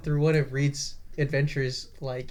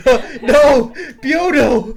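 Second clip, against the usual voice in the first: laughing; 10 semitones higher, 12 dB louder, much slower than usual. Real, not acted. Mock grief while laughing.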